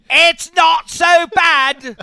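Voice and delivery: loud, high, drawn-out Southern drawl; over-the-top slow Southern whoop; awful Southern accent. Acted. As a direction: holler it in a hammed-up Southern accent. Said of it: "Your, your Southern accent".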